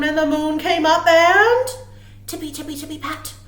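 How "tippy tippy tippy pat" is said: soft whisper of tiptoeing bunnies; whispered sneaking. A narrator's reading. Reading bunny footsteps to children in a whisper.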